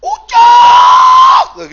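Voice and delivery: very high, drawn-out holler; mother's long, piercing call for her child; full-throated calling yell. Acted. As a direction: holler the child's name from the front of the house. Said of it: "dia langsung teriak depan rumah".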